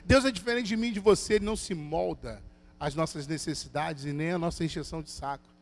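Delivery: usual speaking voice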